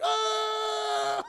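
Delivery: loud high cry as he falls; a sudden falling shout; startled yelp. Retold or imitated. Peter's web snaps.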